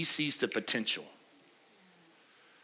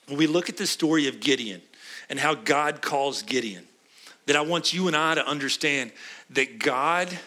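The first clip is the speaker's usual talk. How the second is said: usual speaking voice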